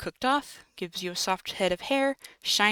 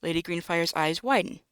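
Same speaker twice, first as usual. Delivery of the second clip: usual speaking voice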